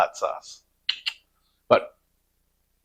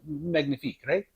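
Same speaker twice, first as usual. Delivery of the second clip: mock Italian accent; 5 semitones higher, 6 dB quieter than usual. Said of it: "sorry about the accents".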